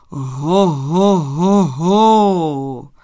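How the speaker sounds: thunderous drawn-out "ho" calls; big resounding hunting-horn hoots; terrible booming holler. A narrator's reading. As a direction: call out like a forest horn, swelling on the last. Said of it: "strašlivě zahlaholil".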